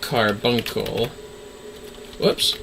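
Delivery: ordinary talk, nothing marked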